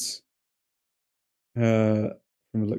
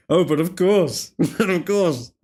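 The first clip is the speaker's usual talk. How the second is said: hammed-up, higher and put-on voice; mock haughty; 5 semitones higher than usual. Streamer mocks the chat's sausage rules, hammed-up snooty tone. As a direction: tease with a mock-snooty but of course. Mocking German sausage etiquette.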